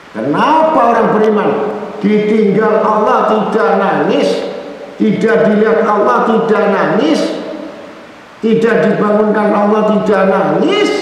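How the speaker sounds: high, impassioned sermon cry; a preacher thundering against hypocrites, in a high, fervent voice; fiery preaching. Acted. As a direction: rebuke the flock with rising fervor. Long impassioned sermon passage.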